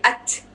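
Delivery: unvoiced th release; breathy t. Shown as a demonstration. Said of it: "थ की sound आती है".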